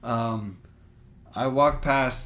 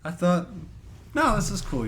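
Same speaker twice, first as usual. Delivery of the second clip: mock indignant disbelief; 7 semitones higher than usual. Mock indignation at being mocked.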